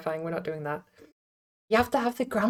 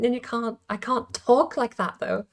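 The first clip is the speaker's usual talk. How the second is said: ordinary delivery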